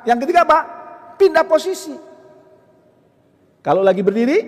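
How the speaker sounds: ordinary delivery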